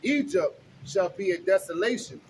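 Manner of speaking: slow, loud preaching read; booming street-preacher reading; scripture reading. Acted. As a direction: proclaim the verses to the crowd. Reads scripture aloud on street.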